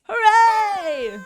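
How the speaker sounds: high, loud mock cheer; hammed-up homecoming cheer. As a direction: shout it like a welcome crowd.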